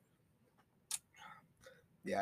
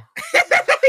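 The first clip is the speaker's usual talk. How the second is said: high loud shrieking laugh; 11 dB louder than usual. Real, not acted. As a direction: burst into a squealing laugh. Bursts out laughing.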